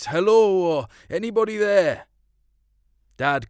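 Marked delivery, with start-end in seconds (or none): 0.0-2.1 loud, high call into the dark; hollering. A narrator's reading. Searchers calling for lost girls, loud holler. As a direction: holler it out across the mountain. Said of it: "we'd holler out".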